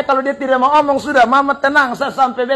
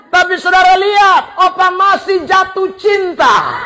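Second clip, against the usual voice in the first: raised, emphatic sermon voice; preaching; 7 semitones higher, 4 dB louder than usual. Acted. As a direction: drive the point home to the congregation.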